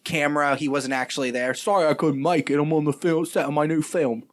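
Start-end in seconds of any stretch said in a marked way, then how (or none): 1.5-4.2 louder British accent impression of John Boyega. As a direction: put on a poor British accent as the actor. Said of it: "It's not how John Boyega sounds".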